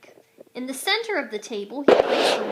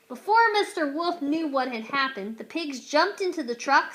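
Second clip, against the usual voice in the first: usual speaking voice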